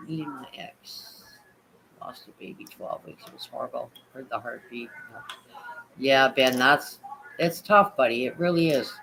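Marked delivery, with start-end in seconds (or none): 0.1-4.9 whispering. Real, not acted. Reading a sad chat message.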